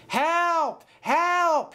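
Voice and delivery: very high cry for help; frightened call. A narrator's reading. James about to be blown up, calling in fear. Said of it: "he called".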